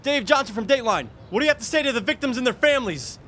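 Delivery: high, pressing reporter voice; pushy TV reporter. Acted. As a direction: chase him down like a Dateline reporter. Reporter ambushes Mister Pirro.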